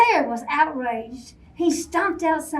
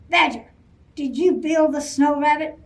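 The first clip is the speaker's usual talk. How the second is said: louder, gruff accusation; angry demand; 2 semitones higher, 5 dB louder than usual. Acted. Angry Bear confronting Badger, loud and gruff.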